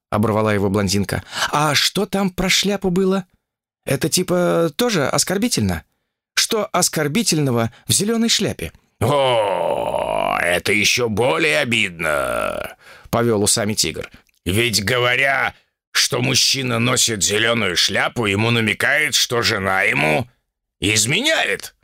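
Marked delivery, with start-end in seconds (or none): 1.5-8.7 put-on higher, curious blonde girl; lightly raised girlish voice; young woman voice. Olga higher voice. 9.0-21.7 lightly voiced wise tiger. Tiger low slow.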